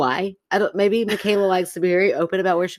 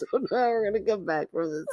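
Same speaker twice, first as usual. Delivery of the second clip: soft, still chuckling; catching breath after laughing; after laughing hard; 2 semitones higher, 6 dB quieter than usual. Real, not acted. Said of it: "my sides hurt".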